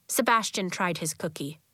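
ordinary delivery

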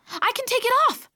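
high, loud, amazed announcement; thrilled, high-pitched cry; excited discovery. A narrator's reading. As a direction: exclaim it in astonished excitement. Announces bracelet can come off.

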